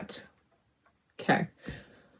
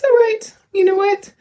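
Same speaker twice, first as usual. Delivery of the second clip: high reassurance; 12 semitones higher, 3 dB louder than usual. Real, not acted. Reassuring herself after a mishap.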